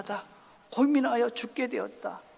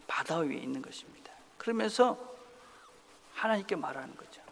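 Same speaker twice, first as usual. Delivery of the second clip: usual speaking voice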